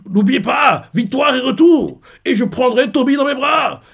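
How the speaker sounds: rising to a full battle cry; commander's rallying shout. Acted. Commander promising his men victory, shouting.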